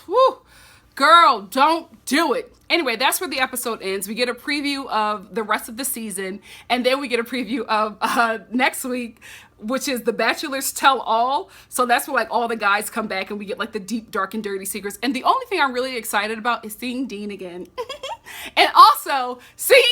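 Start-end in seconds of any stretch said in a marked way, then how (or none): 0.1-2.5 drawn-out plea, louder; sigh, then beg her slowly; slow exasperated warning. Real, not acted. Exasperated at Rachel. 17.7-18.5 breathy high giggle. Real, not acted. Excited about Dean.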